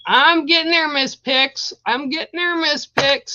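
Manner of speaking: sing-song, brightly promising she is nearly done; sing-song reassurance. Real, not acted.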